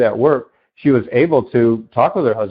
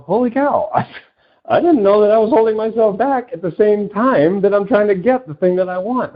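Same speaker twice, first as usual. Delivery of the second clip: much higher, stunned realization; amazed audience member; 10 semitones higher than usual. Retold or imitated. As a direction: gasp out a sudden discovery. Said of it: "and they go".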